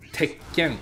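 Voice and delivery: "Tekken" with a swallowed gap before a hard k; doubled consonant. Shown as a demonstration. Teacher showing what the small tsu does, held k in "Tekken". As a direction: cut "Tek" short, hold, then hit "ken". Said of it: "emphasis on the consonant sound".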